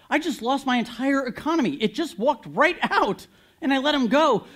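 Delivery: loud, high, indignant king; a furious ruler who just lost everything; outraged pharaoh. Acted. Pharaoh voiced realizing his loss.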